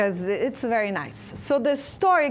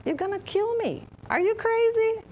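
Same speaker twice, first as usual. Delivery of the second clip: frog voice, much higher, in disbelief; alarmed frog; 12 semitones higher than usual. Retold or imitated. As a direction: squeak it out like a shocked frog. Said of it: "the frog look at him".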